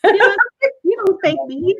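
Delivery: lightly imitated sassy little boy; child with attitude. Son telling mom off about spanking, with attitude. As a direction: pitch up a bit, mock defiance. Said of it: "say it with attitude like this".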